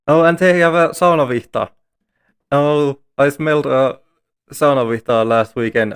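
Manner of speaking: loud, eager and delighted; excited. Real, not acted. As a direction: burst out with real excitement. Excited about sauna item.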